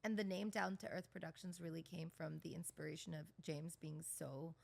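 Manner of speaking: flat, even, no tune; monotone. Real, not acted. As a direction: deliver it in one level tone.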